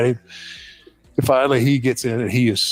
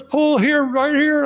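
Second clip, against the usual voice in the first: very high imitated command; urgent passenger; slower than usual. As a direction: urge the driver in a mock-frantic high voice. Passenger demanding to pull over.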